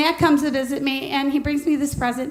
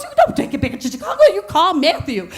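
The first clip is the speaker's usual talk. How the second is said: mock friend; 3 semitones higher than usual. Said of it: "He goes".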